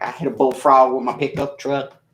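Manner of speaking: put-on country accent, teasing imitation; mock a friend's country accent; Brittany's accent. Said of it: "I do an accent of Brittany's".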